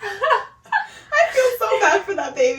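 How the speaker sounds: high, slowed words squeezed through laughter; helpless, tearful laughing voice; laughing hard. Real, not acted. Listener pitying the child while cracking up, laughing hard.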